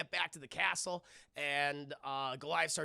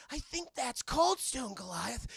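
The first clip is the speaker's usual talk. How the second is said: slow, higher character voice; voiced Lexington line; 6 semitones higher, slower than usual. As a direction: lightly act an astonished onlooker, drawn out. Acts out Lexington spotting Cold Stone.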